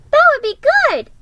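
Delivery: very high, loud, quick agreement; eager. Acted. Little boy agreeing to Mom's plan, high eager burst. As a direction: jump in happily. Boy likes the flag idea.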